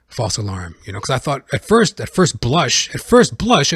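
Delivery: ordinary talk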